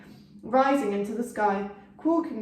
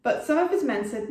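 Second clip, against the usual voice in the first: ordinary delivery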